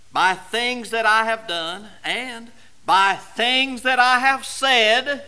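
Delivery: steady pulpit emphasis; preaching. Acted. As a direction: lean into it like a preacher pressing home a point. Preaching, rising again.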